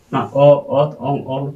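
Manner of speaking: letters said one by one for their sound; teacher demonstrating ᱚ ᱚᱛ ᱚᱝ ᱚᱞ; pronunciation demo. Shown as a demonstration. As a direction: say each letter as pure sound. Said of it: "ᱠᱮᱵᱚᱞ ᱩᱪᱪᱟᱨᱚᱱ ᱠᱟᱱᱟ".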